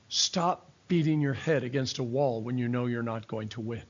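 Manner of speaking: ordinary talk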